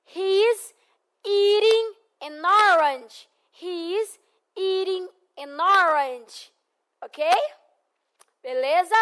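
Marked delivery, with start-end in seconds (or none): 0.0-3.3 "He is eating an orange" slow, with a tapped t in "eating"; slow model sentence. Shown as a demonstration. Teacher models the sentence slowly to be repeated. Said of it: "Vamos lá". 3.5-6.5 "He is eating an orange" slow, with a tapped t in "eating"; slow model sentence. Shown as a demonstration. Teacher models the sentence slowly to be repeated. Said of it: "Vamos lá".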